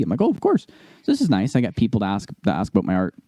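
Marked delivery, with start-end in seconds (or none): 0.2-0.7 high eager reply. Retold or imitated. Eager reply to customer's request.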